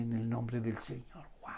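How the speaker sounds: soft, hushed wonder at the words; awed hush. Acted. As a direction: fade almost to a whisper. Reads acclamation, trails into awe.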